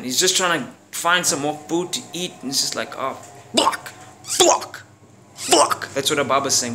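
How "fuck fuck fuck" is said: high squeaky falsetto curses; despairing squeal. Retold or imitated. Ababa hunting for food, high squeaky curses.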